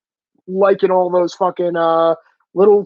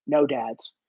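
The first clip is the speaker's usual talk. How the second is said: low quiet take; 6 semitones lower, 7 dB quieter than usual. Retold or imitated. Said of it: "always just go".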